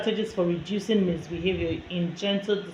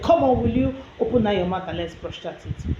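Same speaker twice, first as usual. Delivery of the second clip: louder, mock-impatient parent; impatient order; 2 semitones higher, 5 dB louder than usual. Shown as a demonstration. Parent making a child open up for brushing, impatient order. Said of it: "Now, instead of saying".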